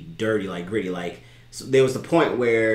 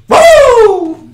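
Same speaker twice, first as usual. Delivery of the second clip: very high, loud wrestler whoop; Ric Flair; 20 dB louder than usual. Acted. Podcaster imitating his favorite wrestler, piercing whoop. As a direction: let out a piercing catchphrase whoop. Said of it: "I go Ric Flair".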